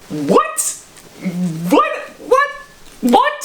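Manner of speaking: very high, squeaked mock disbelief; stunned disbelief. Sleeper hearing a stranger slept on the couch, high-pitched disbelief. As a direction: squeak each one as a separate baffled question. Acted stunned reaction to intruder.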